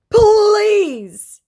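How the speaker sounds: very high, loud, stretched "Please" like a whining plea; begging scammer. Retold or imitated. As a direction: beg pathetically, overdoing it. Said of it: "he begs".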